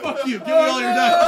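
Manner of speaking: loud, high, playful outrage; mock angry demand. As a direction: bark it like a furious DM. DM jokingly punishing a player.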